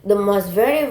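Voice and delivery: ordinary talk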